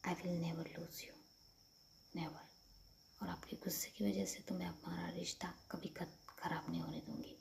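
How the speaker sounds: soft, hushed reassurance; tender vow. Acted. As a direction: vow it quietly and lovingly. Acted tender vow after forehead kiss.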